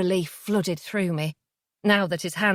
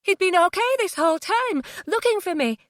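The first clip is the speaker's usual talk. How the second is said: high, elated rush of hope; overjoyed, excited narration; joyful relief; 10 semitones higher than usual. A narrator's reading. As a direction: pitch soaring with happy disbelief. Believes lover is alive.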